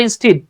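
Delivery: "instead" as in-steed; wrong "instead", rhyming with need; rejected mispronunciation. Shown as a demonstration. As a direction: say "instead" with a long ee, as the wrong form. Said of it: "It's not instead".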